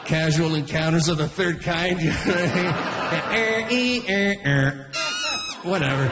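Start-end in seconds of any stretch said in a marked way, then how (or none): 2.0-5.6 high, weird imitated squeals; alien noises. Comic mimicking aliens on dating sites, weird noises. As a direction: make silly alien mating sounds.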